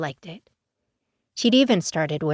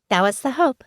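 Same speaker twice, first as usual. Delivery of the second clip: high, louder cheeky grin in the voice; bright smile; 7 semitones higher, 4 dB louder than usual. A narrator's reading. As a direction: confess the scheme with a smile. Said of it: "with a bright smile".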